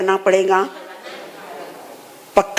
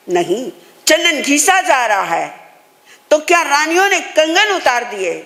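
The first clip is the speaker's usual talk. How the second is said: ordinary talk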